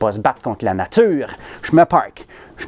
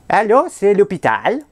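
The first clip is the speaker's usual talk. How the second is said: put-on phony Haitian accent, pitched up; bad Haitian accent; 7 semitones higher than usual. Pretending to be a hospital nurse calling a friend, clumsy Haitian accent. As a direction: fake a Haitian nurse badly on the phone. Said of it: "accent poche haïtien que j'ai pris".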